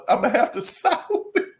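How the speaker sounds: giggling through it; fighting back laughter; laughing. Real, not acted. Host conceding to D T, laughing. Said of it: "I don't know why I'm laughing".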